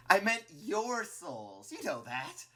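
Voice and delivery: high, drawn-out false reassurance; disingenuous. A narrator's reading. Flowey correcting his slip to Clover, disingenuous. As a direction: oversell an obvious lie. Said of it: "Flowey says disingenuously".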